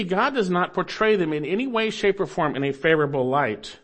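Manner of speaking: ordinary talk, nothing marked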